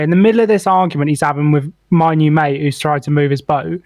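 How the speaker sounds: ordinary talk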